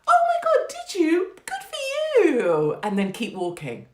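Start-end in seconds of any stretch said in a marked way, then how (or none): 0.1-2.8 very high, loud, slow squealing praise; shrieking over-the-top congratulations; gushing mock delight. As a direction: overwhelm them with fake enthusiasm.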